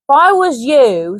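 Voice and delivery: light Cockney trader voice; Cockney Hanson. Acted. Hanson flatters Baines, light Cockney persuasion.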